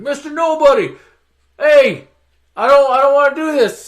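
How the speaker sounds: high, loud mock protest; the Rock shouting from jail before the riot; calling out. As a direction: call out like he's stuck in a cell. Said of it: "He's like".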